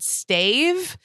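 puzzled rising Stave?; high questioning "Stave?"; confused Stave. Retold or imitated. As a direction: ask "Stave?" back, lost. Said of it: "And I said".

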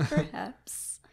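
mock-mysterious whisper; breath-only imitation of a mutter; whispered echo. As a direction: whisper it back, imitating a musing villain. Echoing the muttered line playfully.

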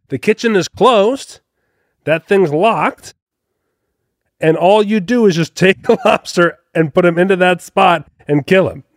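high-pitched, exasperated mock scolding; mock screamed reprimand at ordinary volume; retold screaming bartender. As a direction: imitate a worked-up bartender, slow and high. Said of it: "the bartender just starts screaming".

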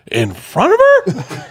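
very high, drawn-out incredulous question; mock horror, stretching the words out high; shocked disbelief. Imitating a friend appalled by the couple's confession, shocked disbelief.

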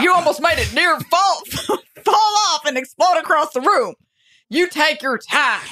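high, laughing coach voice; football coach. Pretend. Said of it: "the football coach was asked to teach".

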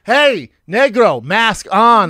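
high, loud, slow barked order; angry director shout. Retold or imitated. Director yelling at extra to put mask back on, angry shout. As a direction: yell it down from the director's chair. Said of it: "director yells cut".

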